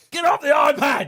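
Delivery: put-on high sharp yell at a kid; mock parent shout, high and sharp. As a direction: bark at the kid to get off the iPad. Parent yelling at kid now.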